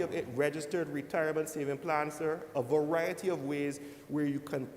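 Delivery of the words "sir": normal talking voice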